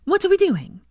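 high, loud eager question; gleeful. A narrator's reading. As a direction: burst in, bright with glee. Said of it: "her face full of glee".

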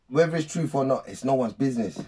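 normal talking voice

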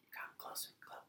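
put-on whispered dying old man. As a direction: breathe it weakly, beckoning. Dying master beckons.

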